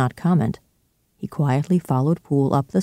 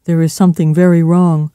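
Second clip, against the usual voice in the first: loud, urgent alarm; crying out; 2 semitones higher, 7 dB louder than usual. A narrator's reading. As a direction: cry out that trouble is here. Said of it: "cried Poole".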